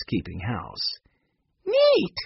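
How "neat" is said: high delighted shout. A narrator's reading. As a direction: yell it out, thrilled. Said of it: "Benny shouted".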